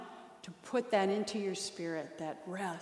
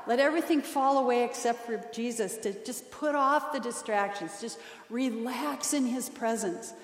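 ordinary delivery

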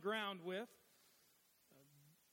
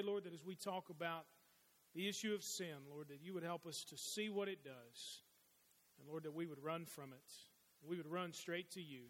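soft, hushed prayer to the Lord; 4 semitones lower, 4 dB quieter than usual. Acted. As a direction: pray quietly and humbly, head bowed. Opening prayer before the sermon.

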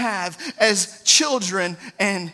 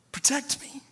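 pleading prayer; 4 semitones higher than usual. Acted. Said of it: "he cries out to his daddy".

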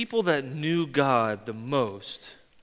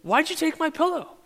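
much higher, teasing question; husband teasing wife at the hair dryer; mock accusation; 12 semitones higher than usual. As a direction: playfully accuse his wife.

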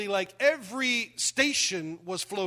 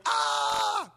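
loud, higher, short cry of fright; panicked yelp; 7 semitones higher, 4 dB louder than usual. Retold or imitated. Pastor acting out his panic on the high ropes course, a yelp. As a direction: yelp in mock terror.

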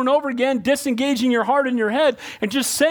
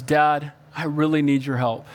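sincere plea; 7 semitones lower than usual. Retold or imitated. Child asking father sincerely.

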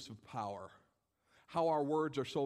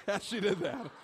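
laughing; 7 semitones higher, 4 dB louder than usual. Retold or imitated. Classmate laughing at teacher's fall.

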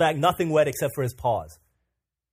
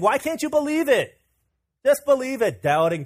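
exasperated; 9 semitones higher, 4 dB louder than usual. Retold or imitated. Exasperated at doubting Thomas.

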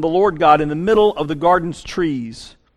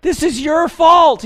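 much higher, loud indignant shout; Adam blaming God; 9 semitones higher, 4 dB louder, slower than usual. Retold or imitated. Adam shouting blame at God, high and loud. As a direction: whine it up high and loud, pointing the finger. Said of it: "The man said".

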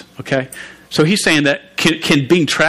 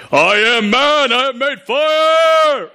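higher, slow chest-thumping caveman boast; puffed-up Tom Hanks; 10 semitones higher, slower than usual. Retold or imitated. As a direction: puff yourself up and proclaim it slowly. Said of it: "He puffs himself up".

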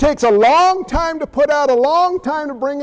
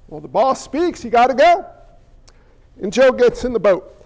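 usual speaking voice

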